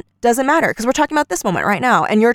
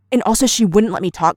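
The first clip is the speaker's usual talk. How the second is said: ordinary talk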